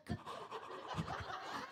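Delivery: sharp whispered gasp of fright; startled gasp. Retold or imitated. Comic reliving the moment she saw the cricket, gasp. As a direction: gasp as if the bug just appeared. Sees cricket on pantry door.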